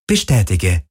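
lightly voiced ship's computer; androgynous ship voice. A narrator's reading. Ship's computer confirms Akina's command, androgynous. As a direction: acknowledge the order as the ship. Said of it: "antwortete die androgyne Stimme des Schiffs".

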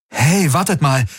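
high, sudden excited call. A narrator's reading. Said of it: "rief er aus". (aufgeregt ausrufen)

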